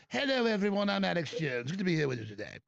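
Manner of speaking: mock English accent, falling in pitch. Host parodying himself speaking in England, English accent. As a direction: greet the crowd in a put-on English accent. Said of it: "when I go to England, I go".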